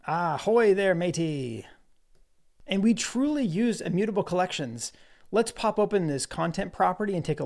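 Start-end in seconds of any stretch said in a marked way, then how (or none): 0.1-1.7 light hearty pirate greeting; a quick hammy pirate hail. Pretend. Prompt asks to talk like pirate.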